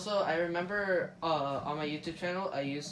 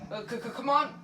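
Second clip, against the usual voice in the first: high, loud, panicked call; panting; 6 semitones higher, 5 dB louder than usual. Acted. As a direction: urge him on, out of breath. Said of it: "panted Cuphead".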